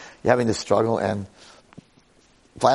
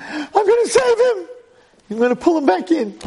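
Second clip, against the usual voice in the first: high, loud frantic shout; hammed-up frantic squeal; panicked cry; 4 dB louder than usual. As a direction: shriek it as a desperate rescuer. Twin panics to save brother.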